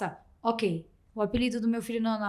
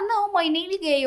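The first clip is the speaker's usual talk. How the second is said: voicing her son; 8 semitones higher, 4 dB louder than usual. Mother voices her son's reply.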